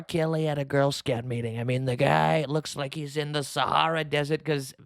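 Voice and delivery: ordinary delivery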